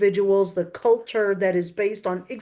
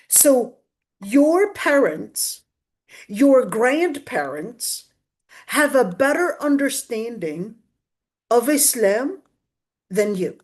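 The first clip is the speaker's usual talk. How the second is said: slow and deliberate. Real, not acted. Slow emphatic point to audience.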